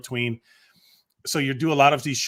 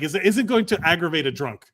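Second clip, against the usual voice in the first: ordinary delivery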